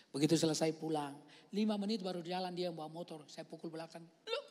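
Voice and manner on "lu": teasing scold. Retold or imitated. Scolding the pastor afterwards.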